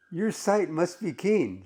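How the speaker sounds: slow, high-pitched sarcastic remark. Acted. Woman teasing a man with magnifying glass, sarcastic. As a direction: draw out the mock compliment. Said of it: "She's making a sarcastic comment".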